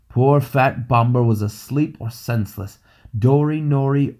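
ordinary delivery

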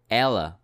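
ela with a clear open E; model pronunciation. Shown as a demonstration. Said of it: "you will find this sound in the word".

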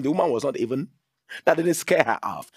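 ordinary talk

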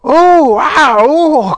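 loud, very high wails; long tumbling howls of pain; crying out. A narrator's reading. Everyone rolling down the hill, crying out. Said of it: "cried everyone".

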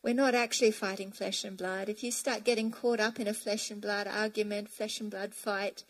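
normal talking voice

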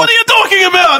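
high-pitched, hammed-up Italian accent; exasperated Italian driver caricature; thick Italian accent. Imitating the delivery driver on the phone, thick Italian accent. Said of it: "I can't not do it with the accent".

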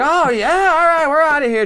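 high, loud call as they leave; firemen calling out. Retold or imitated. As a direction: shout it over breezily.

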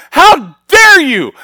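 slow, high, shouted "How dare you?"; furious challenge. Retold or imitated. Grieving son shouting at God, slow, high and loud. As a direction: hurl it up at heaven, slow and loud. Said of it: "I'm looking at God going".

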